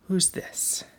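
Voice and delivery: soft whispered question. Acted. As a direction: whisper it to the child.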